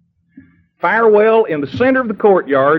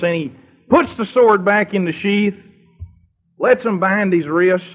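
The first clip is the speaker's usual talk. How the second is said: ordinary delivery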